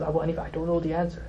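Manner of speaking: a meek sheikh confessing; sheepish admission. Retold or imitated. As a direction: own up to it in a small embarrassed voice. Sheikh admits ignorance before Abu Hanifa.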